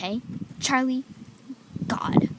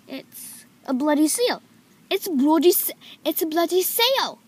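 playful put-on British accent, pitched up; hammed-up British accent, higher voice; 5 semitones higher, 5 dB louder than usual. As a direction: put on a mock-British exclamation. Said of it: "I was saying it in British accent".

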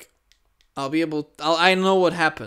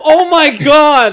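loud, high, slow panicked cry; startled scream; 10 semitones higher, 17 dB louder, much slower than usual. Real, not acted. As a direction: scream in fright. Jump scare continues.

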